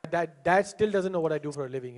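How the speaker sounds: usual speaking voice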